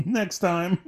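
high, laughing repeat of next time; laughing mock echo. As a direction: laugh while echoing the phrase. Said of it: "and we'll see you next time".

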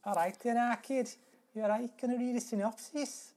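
put-on high-pitched comic Scouse accent; hammed-up Scouser asking cheerily; mock Scouse. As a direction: playfully put on a high Liverpudlian voice. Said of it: "all I can do is Liverpudlian".

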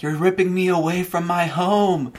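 put-on high, loud, over-the-top childish protest; melodramatic mock scream. Child protesting to parents about moving, hammed-up screaming. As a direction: wail it like a super dramatic ten-year-old.

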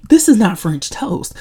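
high, loud, acted protest; mock diva indignation. Diva rejecting the food she was brought, mock indignant.

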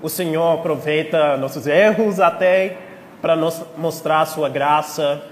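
prayer. Acted. Leads a prayer.

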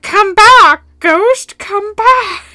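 high, loud hammed-up plea; mock ghost hunter. Zach chasing the ghost down the stairs, hammed-up high call. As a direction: wail after the ghost like a desperate fan. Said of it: "being like".

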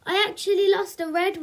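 put-on small boy's complaint to his mum; lightly acted grumble, starting high; complaining child. A narrator's reading. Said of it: "complained Spike".

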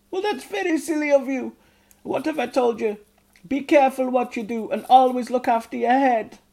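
a high, loud scolding mother's voice; strict goblin mother. A narrator's reading. Mother goblin telling off Ugnok, high loud scold. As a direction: scold him like a strict mum.

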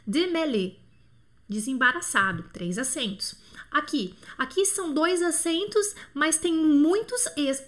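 ordinary delivery

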